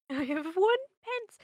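high, slow orphan plea; loud hammed-up beggar boy; Oliver Twist voice. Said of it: "Oliver's very good".

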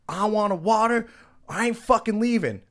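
high-pitched belligerent mock yell; imitated aggravated refusal. Belligerent guest refusing to leave the party, high mock yell. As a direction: dig in like an aggravated troublemaker. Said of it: "he's getting more and more aggravated".

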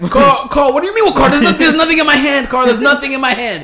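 loud, high-pitched incredulous outburst, retold; exasperated protest. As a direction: act out loud, baffled disbelief at a friend. Acts out his baffled protest to Carl.

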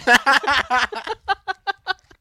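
high howling cackle; loud burst of laughter. Real, not acted. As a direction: crack up hard. Cracking up at own joke.

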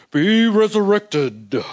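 slow, booming mock pronouncement; grand mock decree. Preacher mocking a grand decree to raise the dead. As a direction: ham up a solemn command. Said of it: "he's not saying".

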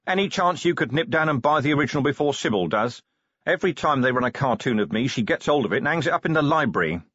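harried commander. Acted. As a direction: rattle it off to the butler. Voices Vimes in story.

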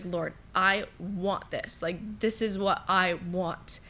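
slowed right down, pleading to the Lord; a slow, earnest plea, half retold; praying slowly at the altar; slow earnest prayer.

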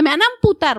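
retold bad news, dropped bluntly; blunt announcement. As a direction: blurt it out, high and loud, then drop. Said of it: "¿Así se la soltaste?". (noticia soltada de golpe)

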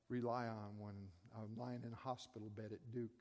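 ordinary talk

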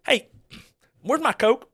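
high hillbilly West Virginia call; high West Virginia accent. Retold or imitated. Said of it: "being from Starks".

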